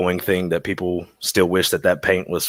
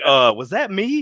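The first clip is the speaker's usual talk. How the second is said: high-pitched, hammed-up disbelief; mock worried question; 10 semitones higher than usual. Guest recalls fearing he was accused, mock worried question. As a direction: act out wondering if you're guilty, high. Retold worry at being accused.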